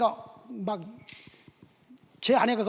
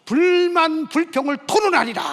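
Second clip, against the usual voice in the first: loud vow; 7 semitones higher, 9 dB louder than usual. Retold or imitated. Vowing to argue with God.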